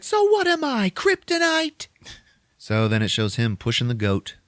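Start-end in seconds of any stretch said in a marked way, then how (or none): 0.0-1.9 very high, strained mock protest; hammed-up outraged squawk; indignant girlfriend. Punk girlfriend being left behind, mock indignation.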